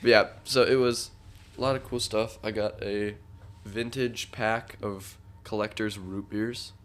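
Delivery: normal talking voice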